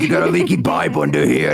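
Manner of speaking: gruff plumber. Retold or imitated. Big baby playing a plumber checking a pipe, low plumber voice. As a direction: talk like a tradesman sizing up the job. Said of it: "the big baby walks in and goes".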